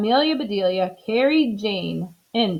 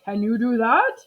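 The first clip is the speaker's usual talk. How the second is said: higher, patient doctor; doctor explaining; 5 semitones higher than usual. Acted. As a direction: spell out the request. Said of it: "said the doctor".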